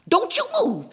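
sudden high, loud order; urgent warning. A narrator's reading. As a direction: burst out high to freeze him. Fox warns bear with hornet nest.

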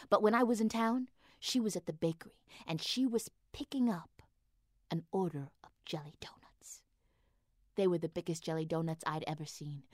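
hushed, dreamy whisper. Acted. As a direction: whisper it like a secret craving. Whispered dreamy donut story.

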